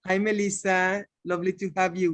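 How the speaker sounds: normal talking voice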